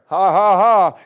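loud scoffing laugh; mocking laugh. Sarah laughs at the promise of a son, loud scoffing ha ha.

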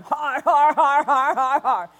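loud imitated har-har laughter; defiant laughing. Retelling a man laughing at the enemy's threat, loud har-har. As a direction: keep laughing right at the enemy. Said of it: "He just kept laughing".

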